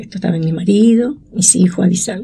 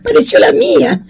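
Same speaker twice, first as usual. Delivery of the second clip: joyful cry. Retold or imitated. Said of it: "pero el grito".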